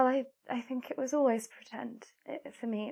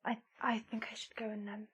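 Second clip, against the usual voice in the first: soft unvoiced whisper; 3 semitones lower, 7 dB quieter than usual. Real, not acted. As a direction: whisper it, embarrassed. Embarrassed retreat.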